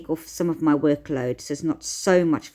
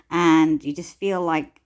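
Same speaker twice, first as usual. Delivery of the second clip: usual speaking voice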